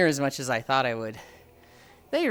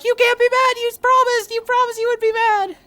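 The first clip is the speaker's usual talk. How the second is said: very high, loud squealing protest; panicked mock scream; 10 dB louder than usual. Nephew with hands at his neck, panicked squeal. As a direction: imitate a nephew pleading at the top of his pitch.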